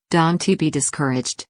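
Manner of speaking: ordinary delivery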